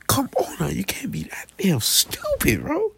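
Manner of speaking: exasperated whisper; voiceless, fed-up whisper. Real, not acted. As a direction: hiss it under your breath in disbelief. Exasperated aside to the rumor girl.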